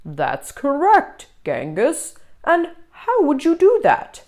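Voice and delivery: stern monster teacher reciting rules. Acted. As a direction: recite the rule like a strict teacher.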